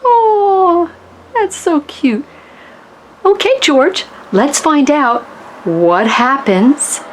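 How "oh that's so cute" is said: usual speaking voice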